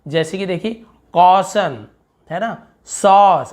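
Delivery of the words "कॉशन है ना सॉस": कॉशन and सॉस slow with rounded "aw"; long "aw". Shown as a demonstration. Teacher reading examples, slow long "aw" in कॉशन, सॉस. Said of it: "आ बोल रहे, कॉशन सॉस".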